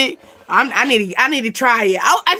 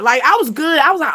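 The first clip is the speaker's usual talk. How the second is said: ordinary talk, nothing marked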